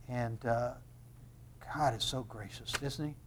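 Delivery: low, very soft musing; hushed reverence. Real, not acted. Preacher marvelling at God's grace, low and hushed. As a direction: let it sink to an awed hush.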